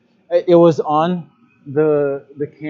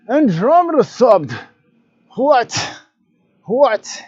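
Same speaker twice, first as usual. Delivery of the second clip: excited; 9 semitones higher, 6 dB louder, slower than usual. Real, not acted. Surprised by a new subscriber.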